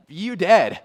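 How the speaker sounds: loud, flat-out "you dead"; blunt verdict. Retold or imitated. Preacher voicing God's abrupt judgment, loud and blunt. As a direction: slam it down as a sudden verdict. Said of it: "and he's like".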